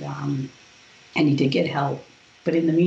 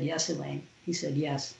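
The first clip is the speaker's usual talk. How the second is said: much softer, solemn yes; grave angel; 10 dB quieter than usual. Retold or imitated. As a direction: archangel answering her, hushed and solemn. Archangel answering gravely.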